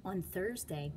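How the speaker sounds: ordinary delivery, nothing marked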